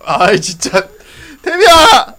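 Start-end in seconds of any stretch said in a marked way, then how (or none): 1.4-2.1 high, loud call. Real, not acted. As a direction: shout the name across the room. Calling out to Tammy.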